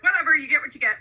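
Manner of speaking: laughing off smudged makeup, carefree. Retold or imitated. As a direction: toss it off with a laugh. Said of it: "I'm like".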